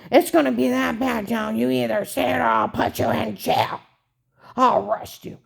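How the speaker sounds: bully her with each line; threatening agent. Retold or imitated. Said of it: "he said".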